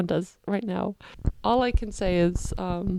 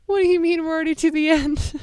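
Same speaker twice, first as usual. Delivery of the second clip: high, breaking voice, close to sobbing; crying; 9 semitones higher, 5 dB louder than usual. Real, not acted. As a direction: cry it out in disbelief. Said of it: "I'm gonna start crying again".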